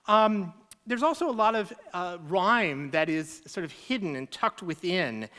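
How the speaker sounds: ordinary talk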